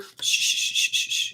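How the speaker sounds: soft unvoiced shh to a crying baby; whispered shushing. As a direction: hush a baby gently. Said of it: "when they cry".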